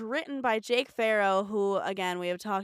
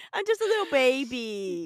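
put-on high whiny baby voice; 5 semitones higher, 4 dB louder than usual. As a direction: talk like a little baby. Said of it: "Adults doing baby voice".

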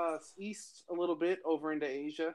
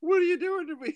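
much higher, panicked mock cry; Step Brothers quote; 5 dB louder, faster than usual. A host quoting a movie scene about a buried wife, in a high panicked mock squeal. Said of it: "that scene in Step Brothers where he's like".

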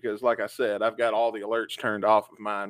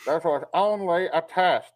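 emergency broadcast voice; 5 semitones higher than usual. Retold or imitated. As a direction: imitate the alert recording over the speaker. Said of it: "And then they play".

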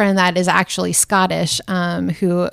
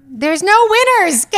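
high, loud outburst of objection; exclaimed protest; 11 semitones higher, 5 dB louder than usual. Real, not acted. Arguing with a friend over dating, loud high protest. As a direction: cry out in mock-heated protest.

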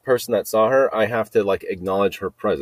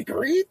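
very high questioning squeak; squeal for "what". Shown as a demonstration. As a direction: squeal it in place of a baffled what. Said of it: "instead of saying, "What?" I'm gonna go".

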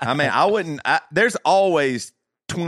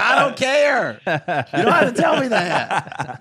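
dismissive retort; 11 semitones higher, slower than usual. Retold or imitated. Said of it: "I'm like".

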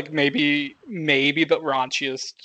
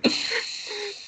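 squealing laugh, high and breathy; high burst of laughter; 5 dB quieter than usual. Real, not acted. Laughing at a movie quote.

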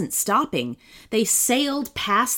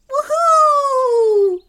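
very high, loud whoop; joyful yell; 8 dB louder than usual. A narrator's reading. Sloth at the wheel of a moving truck, joyful yell. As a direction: whoop with abandon. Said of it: "yelled Sebastian in reply".

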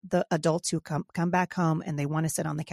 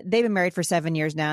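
ordinary talk, nothing marked

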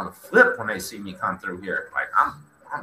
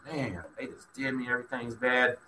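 much softer, defeated self-pity; feeling sorry for himself; 11 dB quieter than usual. Retold or imitated. Injured prisoner pitying himself in the hospital, soft and defeated. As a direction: mutter it like you've given up. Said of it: "I was feeling sorry for myself".

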